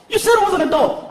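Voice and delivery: put-on high, protesting kid voice; arguing little boy. Son protests to stepdad, high kid voice. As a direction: argue back like a small child.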